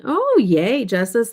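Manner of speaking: high, loud little yay; excited cheer. Real, not acted. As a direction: cheer for a friend's good news. Cheers viewer news.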